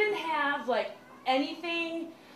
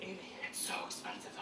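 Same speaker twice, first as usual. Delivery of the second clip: hushed dad; 5 semitones lower, 16 dB quieter, faster than usual. Retold or imitated. Dad hesitating quietly.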